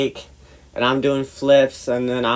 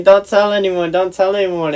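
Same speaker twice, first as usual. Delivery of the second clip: urgent pleading; 7 semitones higher, 5 dB louder than usual. Retold or imitated. Retold pleading after being caught.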